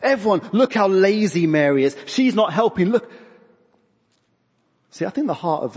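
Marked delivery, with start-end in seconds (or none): 0.0-3.1 loud, high-pitched mock accusation; putting someone down. Martha telling everyone on her sister, hammed-up put-down. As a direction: publicly show up a lazy sister. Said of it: "putting her sister down".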